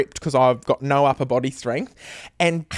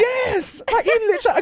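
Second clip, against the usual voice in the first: excited agreement. Real, not acted.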